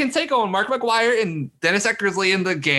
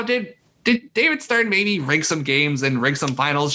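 usual speaking voice

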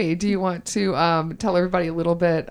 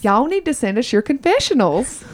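put-on Southern accent; 5 semitones higher than usual. Host asks listeners for confessionals in a Southern accent. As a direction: appeal to y'all in a Southern accent. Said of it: "in my Southern accent".

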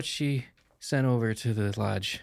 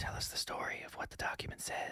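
whisper; 14 dB quieter than usual. Acted. Whispered request to storyteller.

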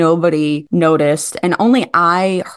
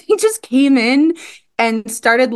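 ordinary talk, nothing marked

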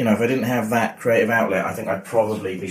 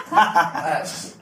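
laughing; 7 semitones higher than usual. Real, not acted. Laughing at own joke.

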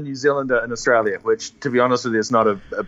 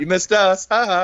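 high-pitched playful jeer; mock them in a silly higher voice; taunting moose; 6 semitones higher than usual. Voicing taunting moose at the wall.

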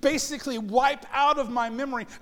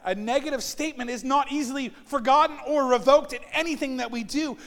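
ordinary talk

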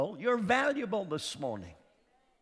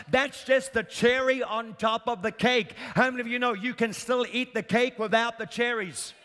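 normal talking voice

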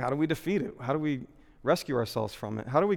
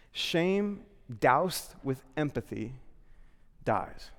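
slow emphatic; 3 dB quieter, much slower than usual. Real, not acted. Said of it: "sermon punchline delivered slowly".

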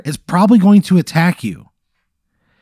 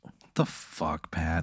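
exasperated mutter; 11 semitones lower, 18 dB quieter, slower than usual. Real, not acted. Player exasperated at absurd GM.

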